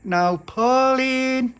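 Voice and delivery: high, drawn-out yell of her name; shouted call. Acted. Little pappy calling Pauline through the letterbox, long loud shout. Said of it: "he shouted through the letterbox".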